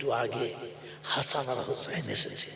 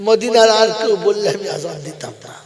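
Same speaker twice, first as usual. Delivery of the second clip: loud, acted lament; impassioned grieving outburst; mock tearful cry; 3 semitones higher, 10 dB louder than usual. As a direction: cry it out loudly, as if weeping. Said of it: "Belal ডুকরে কেঁদে বললেন".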